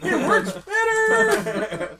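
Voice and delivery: laughing. Real, not acted. Laughing at the kite joke.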